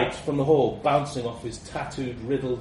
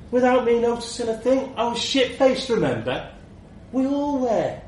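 much higher, louder mocking retort; irritated shout; 11 semitones higher, 5 dB louder than usual. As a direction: fling the words back at him. Chisel snaps back from case.

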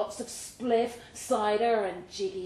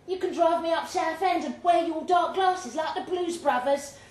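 put-on higher and louder, a young woman full of hope; Michelle's voice; 8 semitones higher, 4 dB louder than usual. Michelle dreaming to Bay about normal life, higher, louder woman's voice.

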